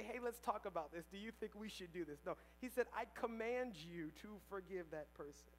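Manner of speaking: ordinary talk, nothing marked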